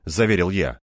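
usual speaking voice

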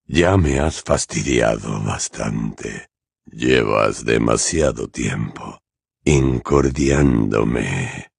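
very slow, low, cold voice; unperturbed villain. A narrator's reading. Voldemort tired of Potter meddling, unperturbed menace. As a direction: draw it out deep, without emotion. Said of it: "dijo sin inmutarse".

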